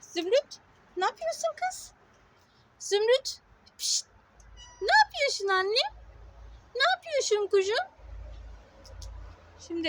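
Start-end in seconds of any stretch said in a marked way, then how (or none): 0.0-7.9 very high sing-song call to the cat; cooing to a cat. Real, not acted. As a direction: call the kitty in a high coo. Calling the cat sweetly. (kediye çok yüksek sesle sevgiyle seslenme)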